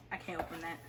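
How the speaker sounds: ordinary delivery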